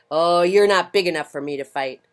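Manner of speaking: loud dismissive brush-off; grouchy ladybug bluster. Acted. Grouchy ladybug backing off from a fight, loud and huffy. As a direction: back down while acting tough.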